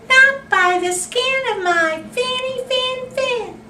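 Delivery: high, squeaky little fish, loud; tiny fish voice. Acted. Little fish refusing the shark, high and squeaky. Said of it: "the brave little fish replied".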